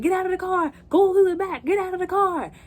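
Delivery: high, loud panicked shouting; frantic yell. Retold or imitated. Ordering the family out of the car, shouting high and loud. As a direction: yell at them to get out now. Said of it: "he's like".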